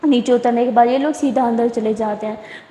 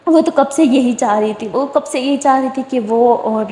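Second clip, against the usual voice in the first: ordinary delivery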